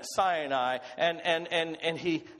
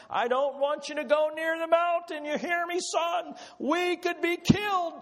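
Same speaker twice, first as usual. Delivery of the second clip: frantic father pleading; 10 semitones higher than usual. Acted. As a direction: beg your son to stay away, voice straining high. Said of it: "Sorry, I was acting too much".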